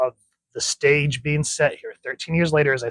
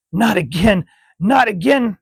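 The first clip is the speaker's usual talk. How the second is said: high, loud frightened plea; a girl jolted awake from a nightmare; terrified cry; 8 semitones higher, 6 dB louder than usual. Acted. Newt waking from nightmare.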